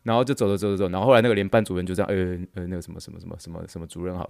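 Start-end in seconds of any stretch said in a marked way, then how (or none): 2.1-4.3 flustered mumble. Retold or imitated. Flustered greeting to boss.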